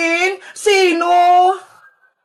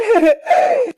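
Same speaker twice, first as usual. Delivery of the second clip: high-pitched little giggle; gleeful giggle; 5 semitones higher than usual. Acted. Girls thrilled by a free parcel, high giggle.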